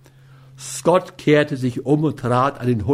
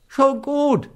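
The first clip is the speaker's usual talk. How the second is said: much higher, quick frightened yielding; a touch of panic in the surrender; hasty fearful give-in; 12 semitones higher than usual. A narrator's reading. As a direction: back down fast out of fear. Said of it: "mit einer Raschheit, die nur aus der Furcht".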